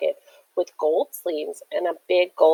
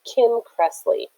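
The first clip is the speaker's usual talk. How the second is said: ordinary delivery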